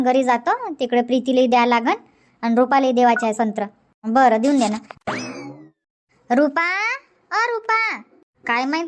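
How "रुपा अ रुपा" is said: high call across the yard. Acted. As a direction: call her name from outside the house. Calling a neighbour from outside. (बाहेरून उंच आवाजात हाक)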